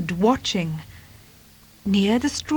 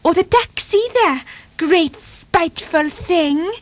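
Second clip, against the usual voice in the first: high, huffy kitten grumble; high-pitched, resentful little voice; ungrateful; 9 semitones higher, 3 dB louder than usual. Acted. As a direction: sulk it out, drawing out the insult. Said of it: "he added ungratefully".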